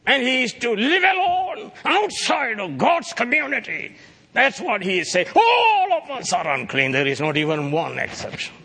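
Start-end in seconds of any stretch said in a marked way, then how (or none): 0.1-6.9 high, loud fervent preaching; impassioned preaching. Acted. Preacher driving home that all are unclean, high fervent shout. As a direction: drive the point home hard.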